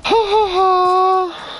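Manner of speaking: high, drawn-out fake laugh; slow mock laugh. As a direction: laugh it out slowly like a taunt. Mock laugh after dying.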